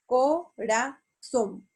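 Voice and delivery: "corazón" slowly syllable by syllable, ZÓN stressed; co-ra-ZÓN, slow and split apart; stress on last syllable. Shown as a demonstration. Teacher showing an aguda word, "corazón" split with stress on ZÓN. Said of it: "La palabra corazón se acentúa en la última".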